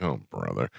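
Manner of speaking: deflated, unimpressed sigh of a line; disappointed groan. Retold or imitated. Moviegoer let down by the alien reveal, quiet deflated groan. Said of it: "you're like".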